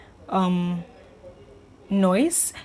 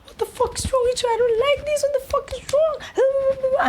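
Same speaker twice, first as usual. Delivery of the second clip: shrieking far higher, near tears; hysterical screaming girlfriend. Retold or imitated. Girlfriend screaming tearfully at her boyfriend, shrill and high. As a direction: scream it at him like she's lost it.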